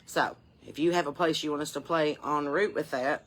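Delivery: usual speaking voice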